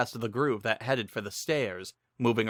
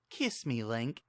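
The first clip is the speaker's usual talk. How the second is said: much softer, intimate ask; soft invitation; 7 dB quieter, slower than usual. Acted. Sing asking Link for a kiss, much softer. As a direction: draw him in softly.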